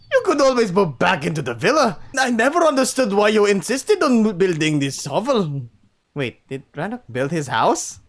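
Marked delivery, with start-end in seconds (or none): 0.1-5.7 loud, raised-pitch Scottish attempt; a try at a Scottish father; Scottish accent. Chief to his son as a father, attempted Scottish accent. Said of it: "give him a Scottish accent. I'll try".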